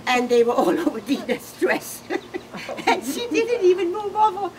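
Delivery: laughing through the words; real laughter breaking in. Real, not acted. Widow telling friends about the tears on Dina's dress, laughing.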